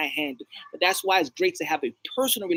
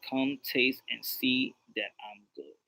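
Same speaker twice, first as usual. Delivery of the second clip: low, soft, slow scripture quote; quoting God; 6 semitones lower, 7 dB quieter, slower than usual. Retold or imitated. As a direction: recite it quietly and unhurriedly. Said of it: "God says".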